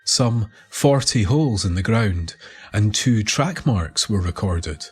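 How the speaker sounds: ordinary delivery, nothing marked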